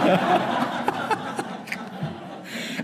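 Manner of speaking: brief airy laugh, breaking off the sermon; real laugh. Real, not acted. Preacher amused by a listener's interjection, laughing. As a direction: laugh along at the heckle.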